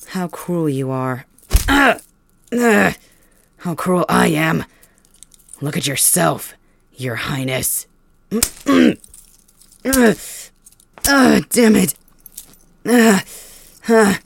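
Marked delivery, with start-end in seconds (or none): 1.7-2.9 high, loud yelps of pain; sharp pain cries. Acted. Prisoner whipped hard, loud pained yelps. 9.9-11.9 loud, higher cry forced through the pain. Acted. Whipped prisoner cursing his captor, pained cry. 12.9-14.2 high, loud yelps of pain; sharp pain cries. Acted. Prisoner whipped hard, loud pained yelps.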